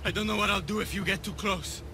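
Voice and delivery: high, anxious plea; frightened warning. Acted. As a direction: warn them off, afraid of yourself. Fears harming the other.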